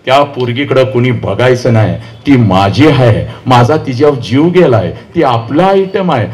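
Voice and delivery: put-on low, possessive boy's warning; hammed-up teenage tough; mock gang boy. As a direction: act the swaggering young lover staking his claim, low. Acts gang boy claiming a girl.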